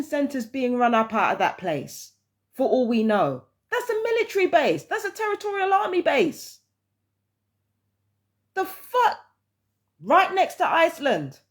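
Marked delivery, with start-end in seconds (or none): 3.7-6.6 high-pitched indignant disbelief; incredulous, pitch shooting right up; real incredulity. Real, not acted. Incredulous outburst about army base. 8.5-11.4 high-pitched, real disbelief; outraged disbelief, pitched way up; incredulous outburst. Real, not acted.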